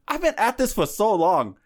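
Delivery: high, loud mock complaint; imitating frustration. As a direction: act out an exasperated girlfriend. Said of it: "she gets frustrated".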